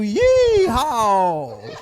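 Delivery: high loud hammy cowboy yeehaw; fake cowboy accent. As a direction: whoop it like a cartoon cowboy. Cowboy greeting.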